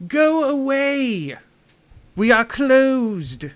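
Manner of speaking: high, loud, slow yell from behind a door; gruff voice from inside. Acted. Hidden shopkeeper yells at visitors through the door, slow high shout. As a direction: bellow them off from inside. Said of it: "and you hear a".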